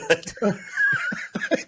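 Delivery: squealing high laugh. Real, not acted. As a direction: burst into a high giggle. Laughing at joke.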